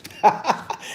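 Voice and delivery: short breathy chuckle; laughing. Real, not acted. Telling viewers about the comments, amused chuckle. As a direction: laugh at your own story.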